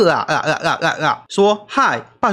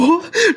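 big laugh. Real, not acted. Watching the clip.

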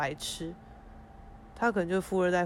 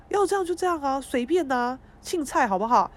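defiant whatever; 6 semitones higher than usual. Retold or imitated. Inner rant about crying.

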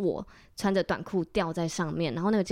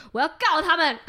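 indignant sister; 8 semitones higher, 5 dB louder than usual. Retold or imitated. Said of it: "然后我姐说".

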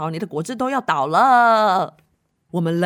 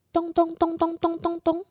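mock thumping; 8 semitones higher than usual. Mysterious thumping noise.

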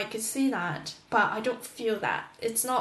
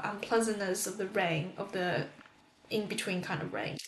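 normal talking voice

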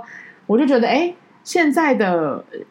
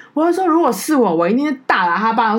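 indignant; 5 semitones higher, 3 dB louder than usual. Retold or imitated. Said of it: "我就觉得好生气".

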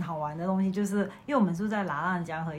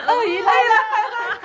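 high, slow, excited call; excited shout; 13 semitones higher, 12 dB louder, much slower than usual. Retold or imitated. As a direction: cry out the alarm, laughing. Kids calling out crocodile alarm.

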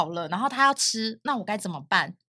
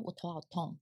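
mock weak moan; 6 semitones lower, 16 dB quieter than usual. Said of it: "然后他就是说".

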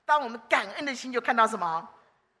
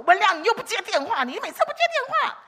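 much higher, louder exasperated scold; 9 semitones higher, 7 dB louder than usual. Retold or imitated. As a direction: tell him off for never answering. Scolding Wenliang on phone. (高声埋怨文亮不接电话)

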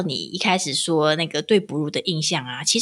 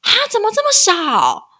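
high, louder mock astonishment; imitating nanny's surprise; 14 semitones higher, 5 dB louder than usual. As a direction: play the shocked nanny, pitched up. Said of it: "她就是很惊讶说".

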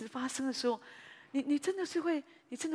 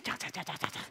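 softer, voiceless patter of words; soft chatter mimic; 4 semitones lower, 4 dB quieter than usual. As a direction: tick off the endless talking under your breath. Mimicking husband's nonstop talking. (轻声念叨)

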